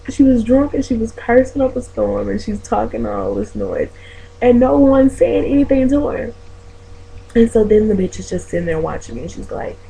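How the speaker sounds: ordinary talk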